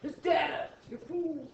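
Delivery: high, scornful kid voice mocking a classmate; mimicking a kid correcting another kid; mocking know-it-all kid. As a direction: sneer it like a smug know-it-all. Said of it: "half the other class is like".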